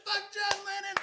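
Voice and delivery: very high-pitched mock yell; retold yelling. Shouting back at his rival as they part ways, imitated high yell. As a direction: imitate a parting holler without full volume. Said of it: "we're still yelling at each other".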